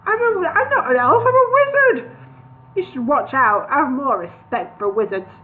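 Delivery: high, loud indignant wizard. A narrator's reading. Gandalf indignant at the miserable dwarves. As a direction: bristle with offended pride.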